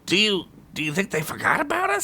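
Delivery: fast, high, jittery; whisper-close scared goblin; anxious goblin voice; nervous goblin. Acted. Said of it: "Skunk said as he sidled up".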